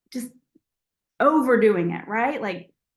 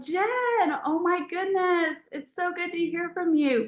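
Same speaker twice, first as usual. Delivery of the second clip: bright, gushing hello to a friend; gushing greeting; 8 semitones higher than usual. Shown as a demonstration. Greeting her friend Jen on the phone, gushing with delight. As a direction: pour on the delight. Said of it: "versus like".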